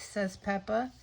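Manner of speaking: plain reading voice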